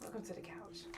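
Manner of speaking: "Welcome to the couch" breathed as a soft whisper. Shown as a demonstration. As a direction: hush it like a secret greeting. Repeated show-title line.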